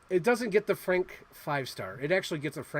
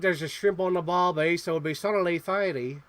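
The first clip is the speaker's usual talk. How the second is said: hammed-up amateur Australian accent; stock-phrase Aussie impression, broadly put on; 4 semitones higher, 9 dB louder than usual. Shown as a demonstration. Host attempting the film's Australian title, Australian accent. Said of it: "how to do an Australian accent".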